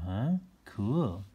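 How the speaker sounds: plain reading voice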